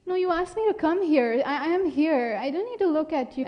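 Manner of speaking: put-on high-pitched, hurried little boy; protesting child. Child protests to his mother.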